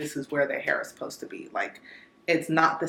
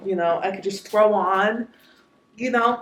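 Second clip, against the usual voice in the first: ordinary talk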